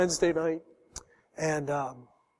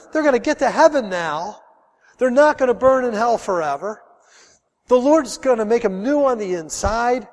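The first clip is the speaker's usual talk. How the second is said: full-force preaching; 5 semitones higher, 6 dB louder than usual. Acted. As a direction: preach it out to the whole church, rising.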